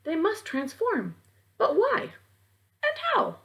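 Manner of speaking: put-on puzzled boy, climbing up in pitch; lightly voiced boy. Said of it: "Sam said".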